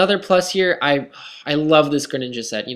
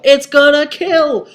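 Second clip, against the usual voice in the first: excited shout; 13 semitones higher, 8 dB louder, slower than usual. Real, not acted. Surprised turn, Greninja survives.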